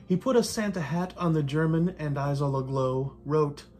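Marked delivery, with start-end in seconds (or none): none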